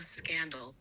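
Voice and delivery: usual speaking voice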